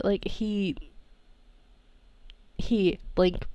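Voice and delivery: ordinary talk, nothing marked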